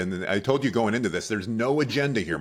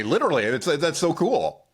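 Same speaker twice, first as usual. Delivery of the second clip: excited; 8 semitones higher, 5 dB louder than usual. Real, not acted. Excited after shared exercise.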